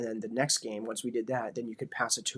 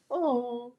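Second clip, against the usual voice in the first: touched little oh; 12 semitones higher than usual. Retold or imitated. Daughter touched by dad's words.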